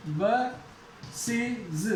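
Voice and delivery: बसेज drawn out very slowly, ending in iz; slow demo. Shown as a demonstration. Teacher slowly models बसेज for the students. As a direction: stretch बसेज out, basiz. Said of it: "बसेज नहीं".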